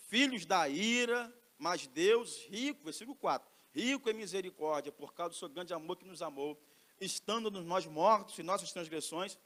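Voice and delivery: loud, emphatic sermon delivery; fervent preaching. Acted. Preacher exhorting the congregation, loud and fervent. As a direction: drive the scripture home to the church.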